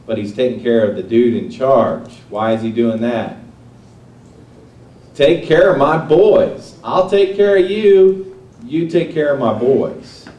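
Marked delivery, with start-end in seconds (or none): 5.1-10.2 a hint of an earnest father, higher, louder and slower; lightly voiced father urging his boy; fatherly plea. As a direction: lean into it like a dad pleading with his son. Preacher voices the father Jesse.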